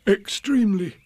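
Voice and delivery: high, startled blurt; jolted awake. Acted. Pooh caught dozing by Rabbit's question, startled. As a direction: jerk awake and answer at once. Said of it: "opened his eyes with a jerk".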